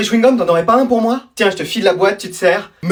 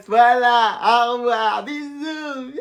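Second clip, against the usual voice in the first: honeyed phone goodbye; 3 semitones higher, 5 dB quieter, much slower than usual. Acted. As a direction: coo your goodbyes, trailing away softly. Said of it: "t'as une voix toute mielleuse". (au revoir mielleux qui s'éteint)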